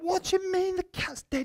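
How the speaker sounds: high-pitched mock astonishment; shocked disbelief. Pastor phoning his assistant about the cat, mock-shocked. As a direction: squeak it out in hammed-up disbelief.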